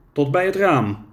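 plain reading voice

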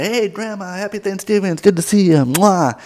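high-pitched dutiful grandchild greeting; put-on family-visit sweetness; mock sweet greeting. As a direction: sing out a sweet hello and finish on a kiss. Acting grandchild greeting grandma with kiss.

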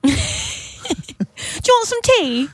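high hammed-up English housewife; mock English accent. Ex-wife changing the subject with the cabbie, mock English accent. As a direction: offer it in a badly faked English trill. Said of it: "butchering this accent".

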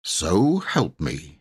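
ordinary delivery

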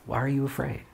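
a quiet, tender repeat; soft echo. Shown as a demonstration. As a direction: let "Why are you afraid?" fall to a near hush. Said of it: "In peace".

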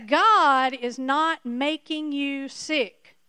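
"God is not making you sick" spelled out word by word, slow; slow emphatic repeat. Shown as a demonstration. Preacher repeating the key point to the congregation, slow and emphatic. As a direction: drive each word home slowly. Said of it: "Do I need to repeat?".

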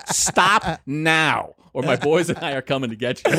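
retold no-nonsense order in a cop's accent; dead serious threat. Cop warning plumbers to stop, dead serious. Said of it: "he wasn't, like, joking".

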